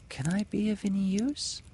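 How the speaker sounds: high, small timid voice; timidly. Acted. The kite's tail timidly offering help. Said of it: "as if saying timidly".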